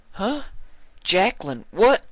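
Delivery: high, shocked disbelief; jolted into confusion; Applejack startled. Acted. Applejack stunned by his words.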